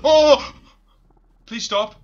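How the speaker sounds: loud panicked yelp; scared horror game streamer. Real, not acted. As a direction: beg it to stop, panicking. Monster chasing the player.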